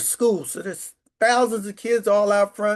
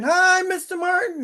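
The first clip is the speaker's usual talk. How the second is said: cheery greeting; 10 semitones higher than usual. Retold or imitated. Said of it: "people are honking".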